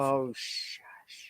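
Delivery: hushed whisper. Real, not acted. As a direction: whisper a playful hush. Whispered reply.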